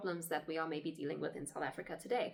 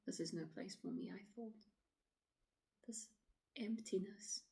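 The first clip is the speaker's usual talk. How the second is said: soft, hushed dramatic monologue with pauses; troubled woman traveller explaining herself; 5 dB quieter than usual. Acted. Woman explaining the lonely road to an officer, subdued. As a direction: confide it quietly, pausing between thoughts.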